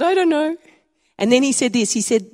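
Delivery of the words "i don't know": high, louder bewildered voice, said again; a helpless kid who can't explain her tears; teen me. Retold or imitated. Teen repeating her answer to her youth leader, high and helpless. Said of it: "I said".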